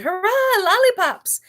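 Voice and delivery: high, loud gleeful cheer; cheering shout. A narrator's reading. Crocodiles cheering for lollipops, loud high shout. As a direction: shout it out like a happy crowd. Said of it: "A whole chorus of voices shouted".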